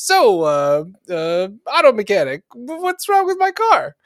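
high, slow cartoon penguin voice. Penguin asking the mechanic about his car, high and squeaky.

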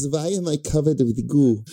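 a giggly Janosch line, pushed out loud; Janosch impression. Correcting his co-host's Janosch quote, loud and laughing. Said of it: "Played wonderfully by Peter McNichol is quoted".

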